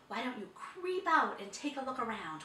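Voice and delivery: plain reading voice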